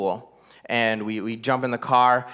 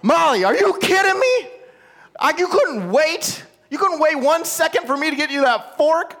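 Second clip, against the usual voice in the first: put-on high, strained outburst at a kid; losing it; 13 semitones higher than usual. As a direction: reenact an exasperated dad blowing up, pitch way up. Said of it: "I lose it on Molly".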